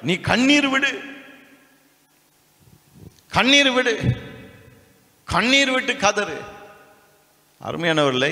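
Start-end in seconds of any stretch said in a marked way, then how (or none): 0.0-6.4 mock preacher urging. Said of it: "நான் உன் கண்ணீரை கண்டேன்னு".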